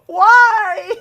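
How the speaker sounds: high, slow wailing sob; loud mock sobbing after a loss; half-joking crying. Real, not acted. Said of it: "I'm gonna cry".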